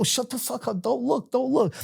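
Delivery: high, soft urgent hush; hissed hush. Retold or imitated. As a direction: hush a friend so the cops don't notice. Hushing friend beside cops.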